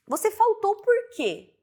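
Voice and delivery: "quê" pitched high and stressed, with a rising question tune; "quê" said strong and high as the question ends. Shown as a demonstration. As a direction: land hard and high on "quê". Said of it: "Ele vai dar a entonação mais forte".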